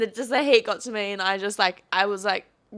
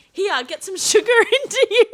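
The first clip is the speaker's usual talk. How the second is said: laughing mock offer; 10 semitones higher, 5 dB louder than usual. Friend Ellen offers Coke.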